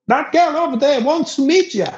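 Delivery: high, eager call. Retold or imitated. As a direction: tip him off like a pushy wingman. Local repeats about the girl.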